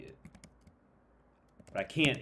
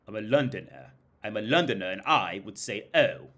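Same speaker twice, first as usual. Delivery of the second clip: British accent; 6 dB louder than usual. Shown as a demonstration. Vlogger copying a video clip, imitated British accent. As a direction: mimic a Londoner's British accent, forcefully. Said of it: "then I'll imitate it".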